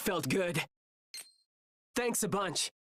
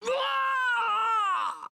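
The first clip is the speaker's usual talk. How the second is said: high, strained cry of pain; pained scream; 4 dB louder than usual. Acted. Fighter knocked down by an enemy, screaming in pain.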